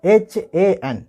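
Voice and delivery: एच ए एन spelled letter by letter, very slow; spelled out slowly. Shown as a demonstration. Teacher slowly spells the English letters for students. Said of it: "English के अंदर जैसे हम लिखेंगे जहाँ".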